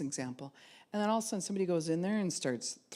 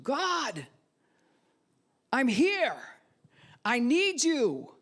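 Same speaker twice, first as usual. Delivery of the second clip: high, loud, earnest crying out; faith cry; 9 semitones higher, 7 dB louder, much slower than usual. Shown as a demonstration. Preacher demonstrates a faithful cry to God. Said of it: "Faith is when you cry out to him".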